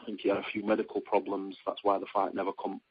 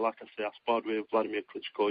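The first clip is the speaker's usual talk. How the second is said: ordinary delivery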